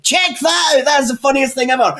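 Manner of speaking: high, laughing shout; howling with laughter. Retold or imitated. Laughing at a woman stuck in her jumper, high laughing shout. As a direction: crack up while yelling it out. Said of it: "proper howling".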